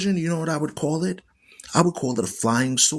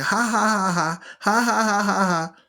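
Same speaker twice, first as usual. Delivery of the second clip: high sarcastic "ha, ha" laugh; mock mocking laughter; 6 semitones higher than usual. Preacher imitating people who laugh at Gaza, sarcastic. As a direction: sneer it out like the mockers. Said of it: "they're saying".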